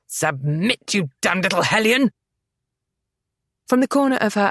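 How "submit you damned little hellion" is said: growled. A narrator's reading. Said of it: "Peregrine growled".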